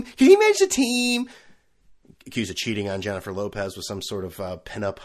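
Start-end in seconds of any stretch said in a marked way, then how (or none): 0.0-1.3 higher, louder, leaning on he; mock insistence. Mocking A-Rod fans, can he manage the team, loud insisting. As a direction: push the question again, louder and higher.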